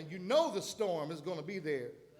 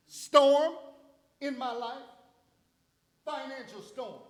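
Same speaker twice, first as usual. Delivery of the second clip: preaching at full force; 5 semitones higher, 5 dB louder than usual. Real, not acted. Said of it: "preaching commands to storms".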